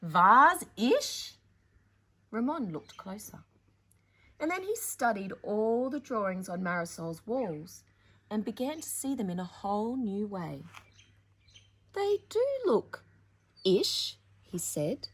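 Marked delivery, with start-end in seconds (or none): 0.0-1.4 loud rising disbelieving vase-ish; puzzled question. Acted. Ramon doubtful about the word. 11.9-14.2 dawning wonder. Acted. Ramon realizes with wonder.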